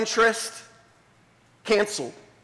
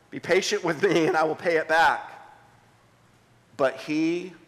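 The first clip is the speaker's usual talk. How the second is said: hushed reading of scripture; 6 semitones lower than usual. Acted. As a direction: read the parable gently to the congregation. Pastor reads the parable aloud.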